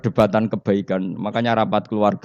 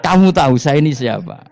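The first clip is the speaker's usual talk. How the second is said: defiant challenge; 6 semitones higher, 8 dB louder than usual. Retold or imitated. Umar confronts Mungkar Nakir.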